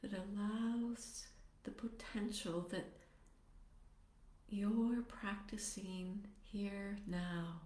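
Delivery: soft, unhurried meditation voice with long pauses; guided meditation. Acted. Teacher settling meditators into posture and compassionate motivation, guided meditation. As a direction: ease them gently into stillness, phrase by phrase.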